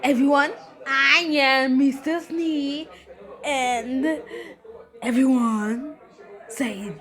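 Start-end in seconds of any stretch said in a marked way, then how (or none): none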